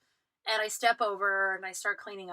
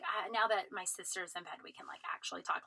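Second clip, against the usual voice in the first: conspiratorial whisper; whispering bride, now we can talk; 2 semitones lower, 9 dB quieter than usual. Retold or imitated. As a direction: hush it so the sister won't wake. Bride whispering once sister's asleep.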